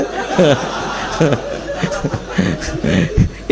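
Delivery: drawn-out quiet airy laughter; long breathy laugh. Real, not acted. Preacher laughing with the audience at the husband joke, breathy laugh. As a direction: let a long soft laugh roll out.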